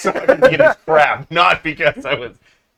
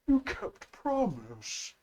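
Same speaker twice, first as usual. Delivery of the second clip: soft, slow, high creature voice; slow, hushed, simple-minded gratitude; grateful Bob; 4 semitones higher, 16 dB quieter, slower than usual. Acted. Plays Bob thanking the party.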